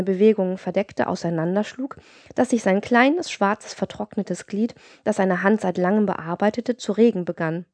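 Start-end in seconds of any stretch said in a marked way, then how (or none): none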